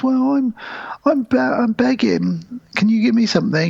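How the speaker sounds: high-voiced hopeful scrounger; pleading beggar. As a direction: beg meekly, pitched right up. Beggar meekly asking for help.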